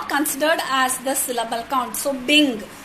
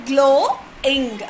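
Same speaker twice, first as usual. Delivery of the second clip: "glowing" split into glow, ing with a gap; syllable by syllable; 3 dB louder than usual. Shown as a demonstration. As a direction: stretch and separate both beats of "glowing". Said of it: "O is one vowel sound".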